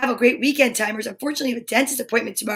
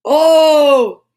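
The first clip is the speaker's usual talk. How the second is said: loud surprised oh; high, loud oh of discovery; 4 semitones higher, 8 dB louder than usual. Real, not acted. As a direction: burst out with a surprised oh. Second vitamin revealed.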